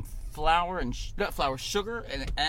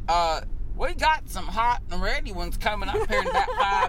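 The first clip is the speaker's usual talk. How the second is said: drive-thru worker voice; 8 semitones higher, 4 dB louder than usual. Retold or imitated. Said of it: "the woman at the window goes".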